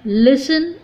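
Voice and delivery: "लिसन" with no T after the S; silent T. Shown as a demonstration. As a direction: say "लिसन", T left out. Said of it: "इसको लिसन ब".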